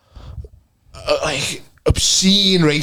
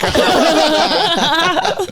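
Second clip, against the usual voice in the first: high, very slow drawn-out mock voice through laughter; high slow laughing caricature; mock proud voice; 14 semitones higher than usual. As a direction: stretch it out laughing. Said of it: "I would say".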